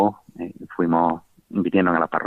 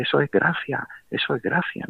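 amazed priest; 7 semitones higher, 3 dB quieter than usual. Retold or imitated. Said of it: "el pobre como asustado".

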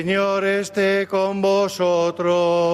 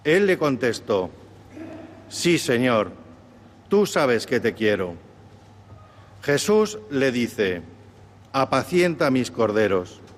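solemn Gospel reading; 4 semitones lower than usual. Acted. Deacon proclaims the Gospel reading.

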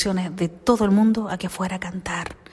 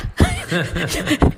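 own laughter; 3 semitones higher, 4 dB louder than usual. Real, not acted. Laughs at her own mix-up.

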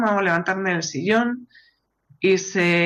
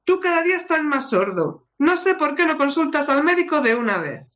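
much higher, exasperated scolding; furious wife; 7 semitones higher than usual. Acted. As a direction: snap at the husband who claims she never answers. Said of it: "La esposa furiosa se da la vuelta".

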